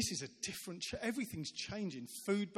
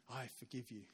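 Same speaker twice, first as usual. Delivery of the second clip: tender forgiveness; 4 semitones lower, 7 dB quieter than usual. Retold or imitated. Forgiving a prisoner, holding hand.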